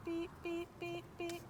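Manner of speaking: high, quiet beeping cue to the dog. Shown as a demonstration. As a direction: chirp the beeps softly and high, like a signal. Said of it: "when I beep, she backs up".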